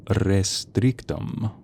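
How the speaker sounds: Restrictum drawn out very slowly; slow, careful attempt at a spell word; sounding it out. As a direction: stretch each syllable of Restrictum.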